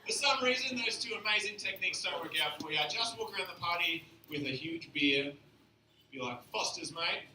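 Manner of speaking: put-on Aussie accent; beer-advert bloke with an Australian accent. Comic coaching men at parties, Australian accent. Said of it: "saying some really offensive things with an accent".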